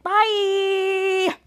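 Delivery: high, long-held goodbye; singsong farewell. Retold or imitated. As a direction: sing out a drawn-out goodbye. Chopper's cheery departing farewell.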